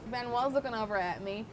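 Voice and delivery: ordinary talk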